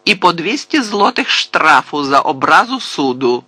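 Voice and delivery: forceful courtroom pronouncement; stern proclamation. A narrator's reading. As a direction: hand down the penalty severely. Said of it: "суворо проголосив суддя".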